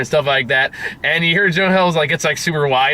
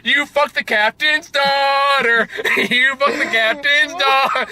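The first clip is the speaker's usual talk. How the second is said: much higher, slow, half-laughing yell of shock; stunned realization shout; 12 semitones higher, slower than usual. Retold or imitated. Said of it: "And he goes".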